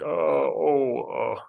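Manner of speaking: loud, high flustered gasps of dismay; panicked realization, blurted out loud. Retold or imitated. Flustered waiter realizing his mistake, loud dismayed oh.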